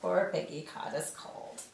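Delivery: usual speaking voice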